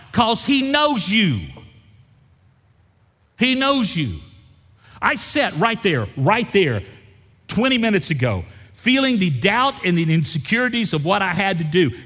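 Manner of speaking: high, loud preaching climax; preaching shout. Acted. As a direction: drive it home, shouting.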